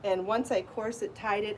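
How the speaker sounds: usual speaking voice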